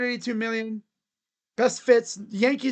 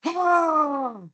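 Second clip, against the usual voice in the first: drawn-out mock war cry, then a high "ha!"; hammed-up warrior shout in a Japanese accent; samurai battle yell; 4 semitones higher, 3 dB louder, much slower than usual. Shown as a demonstration. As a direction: yell the name like a lunatic samurai. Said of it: "you just yell with the top of your lungs".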